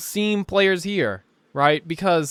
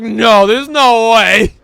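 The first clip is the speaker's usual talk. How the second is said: slow disbelief; 5 semitones higher, 8 dB louder, much slower than usual. Retold or imitated. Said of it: "It's like".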